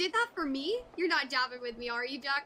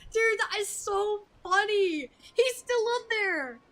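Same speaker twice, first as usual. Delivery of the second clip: amused disbelief; 6 semitones higher, 5 dB louder, slower than usual. Real, not acted. Streamer laughing after scene.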